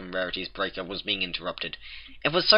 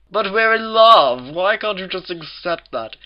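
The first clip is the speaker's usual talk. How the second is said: higher, slow pleading wail; anguished cry; 11 semitones higher, 9 dB louder than usual. A narrator's reading. Rarity cries out for her love to be accepted. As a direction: plead with a breaking heart. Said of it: "Rarity cried out".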